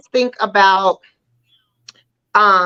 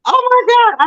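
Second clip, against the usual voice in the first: amused disbelief; 13 semitones higher than usual. Real, not acted. Laughing disbelief at the line.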